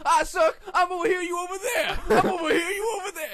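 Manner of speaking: high, loud laughing yell; yelling nonsense. Retold or imitated. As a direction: shout it at the heckler. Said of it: "He's just yelling nonsense".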